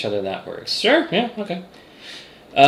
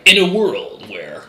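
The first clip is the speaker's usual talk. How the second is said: movie trailer voice; 7 semitones higher, slower than usual. Retold or imitated. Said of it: "He sounds like a movie trailer".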